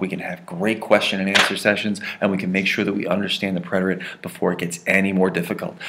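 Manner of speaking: usual speaking voice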